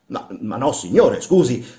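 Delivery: stammered denial with a touch of irritation; hasty, irritated denial. Acted. Workman hastily denying it to his master, irritated. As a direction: rush to deny it, slightly vexed. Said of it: "s'affrettò a negare Spatolino stizzito".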